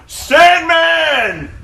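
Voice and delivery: casual aside, then a high loud name shout; hammed-up wrestler callout. Sandman pausing the fight to shout his name. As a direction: announce yourself proudly. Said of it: "he's like".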